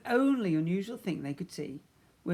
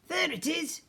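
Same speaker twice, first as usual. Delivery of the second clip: excited spaceman, pitched up high; 13 semitones higher, 4 dB louder than usual. A narrator's reading. Spaceman to Mister Ben on spotting a planet, high and eager. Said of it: "said the spaceman".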